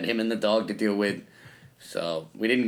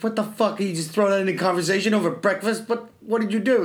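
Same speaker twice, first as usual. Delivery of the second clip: higher, incredulous questioning; pitched-up mock alarm; retold disbelief; 7 semitones higher than usual. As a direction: replay your baffled reaction to a casual bombshell. Retells his startled reaction at breakfast.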